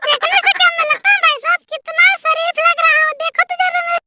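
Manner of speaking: very high cartoonish voice, pleading innocence; squeaky comic character, protesting. Debtor insisting to everyone he is decent, squeaky protest.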